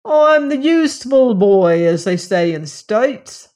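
cheeky Cockney lad showing off; strong Cockney. A narrator's reading. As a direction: announce yourself in broad Cockney. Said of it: "with a strong Cockney accent".

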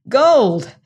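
almost shout it out; excited near shout of gold. A narrator's reading. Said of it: "he almost shouted".

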